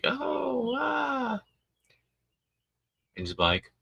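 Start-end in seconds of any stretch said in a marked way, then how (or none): 0.0-1.4 put-on high-pitched baby coo; imitated baby giggle. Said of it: "fucking baby's giggling and like".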